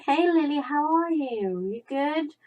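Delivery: high, drawn-out sing-song greeting; cooing at a cat. Retold or imitated. Owner greeting a pretty cat on the sofa, cooing.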